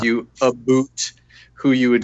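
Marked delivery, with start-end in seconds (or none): 0.4-1.1 Canadian "about", said as "aboot"; "about" with a strong Canadian vowel; full Canadian accent. Real, not acted. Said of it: "He went full Canadian".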